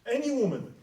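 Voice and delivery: proclaiming. Acted. Sultan proclaims to the city.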